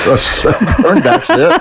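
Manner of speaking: high, laughing disbelief; amused surprised question; laughing surprise. Real, not acted. Reacts to fishing catch.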